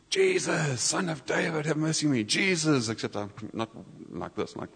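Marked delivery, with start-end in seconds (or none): 0.1-2.9 high, raised call for mercy; half-yelled, pitched-up plea; mock yelling. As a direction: call out like a beggar over a crowd, holding back from a full yell. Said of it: "he starts yelling".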